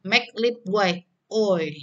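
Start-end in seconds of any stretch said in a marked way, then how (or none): none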